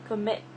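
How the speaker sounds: comMIT, MIT punched; stress on second syllable. Shown as a demonstration. Teacher quizzes learners on O or I, comMIT stressed on the second part. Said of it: "I'm stressing the second part".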